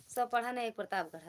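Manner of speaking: saying recited firmly in one go; confident recital. Real, not acted. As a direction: recite it like you have got it right. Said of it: "हाँ ऐसे है".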